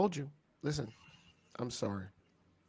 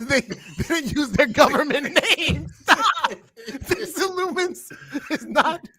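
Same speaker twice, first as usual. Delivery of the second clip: laughing; 10 semitones higher than usual. Real, not acted. Laughing while arguing.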